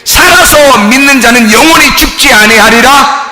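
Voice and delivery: fervent sermon, half shouted; preaching at full force. Acted. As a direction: drive each point home to the pews. Preaching to the congregation.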